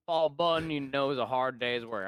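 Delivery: slow, put-on frontier old-timer accent; True Grit accent. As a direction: drawl it in a mock western accent. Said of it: "like, the True Grit accent".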